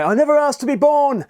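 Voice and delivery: wailed complaint of a child, hammed-up; twelve-year-old's cry. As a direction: pitch it up like an aggrieved twelve-year-old. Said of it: "It's the twelve-year-old's cry".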